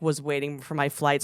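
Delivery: ordinary talk, nothing marked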